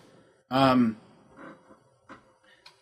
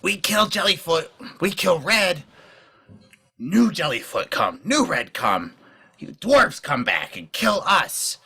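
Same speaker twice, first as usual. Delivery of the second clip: higher, slow goblin voice; worried goblin objecting; 6 semitones higher, slower than usual. Acted. Goblin warning new allies of what follows killing, higher and halting. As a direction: argue it in halting broken speech, pitch raised.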